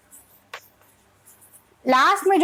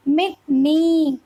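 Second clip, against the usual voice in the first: Mini and ड read slowly, each ending stretched; dragged word endings; much slower than usual. Retold or imitated. Teacher showing parents how children read, endings dragged. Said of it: "जैसे कई बच्चे पढ़ते हैं".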